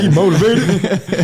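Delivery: high, slow, laughing tease. Real, not acted. Teasing his friend about a girl, laughing. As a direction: stretch it out through a laugh.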